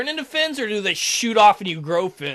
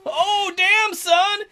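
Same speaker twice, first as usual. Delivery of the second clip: much higher, hyped "oh damn son"; mock hype cheer; 10 semitones higher, 3 dB louder, much slower than usual. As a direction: hype it up like a fan.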